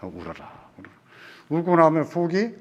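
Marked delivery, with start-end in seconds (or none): 0.0-0.9 much softer, gentle hush; just let her cry, softly; soothing a grieving child, very soft; tender comfort. Retold or imitated. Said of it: "딱 들어주는 게 좋은 것 같아요".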